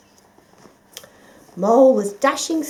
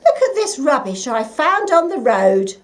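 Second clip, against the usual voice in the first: louder irritated complaint; 5 semitones higher, 9 dB louder than usual. A narrator's reading. Uncle Ferdinand complains to Fox, a touch irritated. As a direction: scold Fox over the rubbish. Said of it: "said an irritated Uncle Ferdinand".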